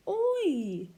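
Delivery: Oi! said loud, lips round then spread; oi sound. Shown as a demonstration. Teacher showing children how to make the oi sound. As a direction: say Oi! from a circle mouth into a smile. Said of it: "Start with a circle and then smile".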